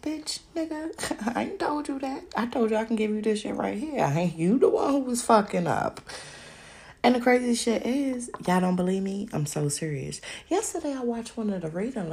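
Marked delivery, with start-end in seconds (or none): 0.0-6.0 high, mocking devil voice that laughs; laughing devil. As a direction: cackle it like Satan gloating. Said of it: "and he just a laughing".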